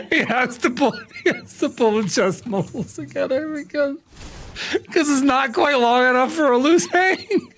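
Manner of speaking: high, giggling speech; words squeezed out between laughs; laughing while talking. Real, not acted. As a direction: talk through the giggles.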